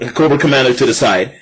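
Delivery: usual speaking voice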